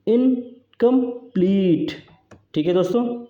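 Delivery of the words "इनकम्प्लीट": इनकम्प्लीट very slowly split, high, pleat stretched; stressed final syllable. Shown as a demonstration. Teacher showing an anapaest to students, इनकम्प्लीट with long stressed pleat. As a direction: separate इन, कम, then hold pleat long and falling. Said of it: "ये जो है long level है".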